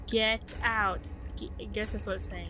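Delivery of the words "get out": loud command, stress on get; firm commanding get out. Acted. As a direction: bark it at an intruder. Reads threatening wall text.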